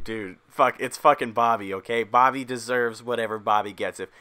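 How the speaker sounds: ordinary delivery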